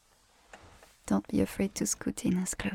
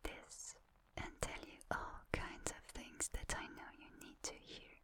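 right in your ear; 17 dB quieter than usual. Shown as a demonstration. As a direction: close whisper right at the ear. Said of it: "allows me to whisper into your ear like".

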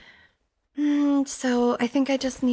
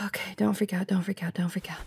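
softer, whispered self-reassurance; hushed panic; 5 semitones lower, 6 dB quieter, faster than usual. Acted. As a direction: whisper to calm yourself down. Panicked self-soothing in the machine.

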